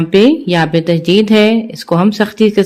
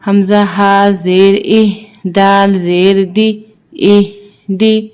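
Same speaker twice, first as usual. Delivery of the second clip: slow, measured chant with long held vowels; Qur'anic spelling recitation; 4 semitones higher, much slower than usual. Shown as a demonstration. Teacher spelling verses for learners, measured chant. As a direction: intone each letter, then blend it.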